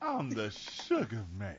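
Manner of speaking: low, soft, drawn-out catchphrase; Sugar Man's deep voice. Acted. Robinson introduces himself by nickname, deep voice. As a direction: drop down and stretch out the nickname. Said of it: "Like his voice got deeper".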